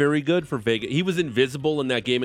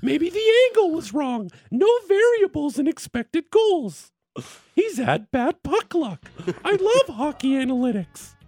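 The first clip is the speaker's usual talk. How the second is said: high, sugary fake-enthusiastic gushing; fake lying voice; 7 semitones higher than usual. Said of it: "this is your line voice".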